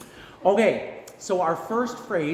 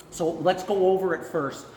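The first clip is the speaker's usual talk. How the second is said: ordinary delivery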